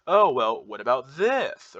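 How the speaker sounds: stretched words swooping up at the end; drawn-out questioning upward lilt; Valley Girl. Shown as a demonstration. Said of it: "the Valley Girl voice".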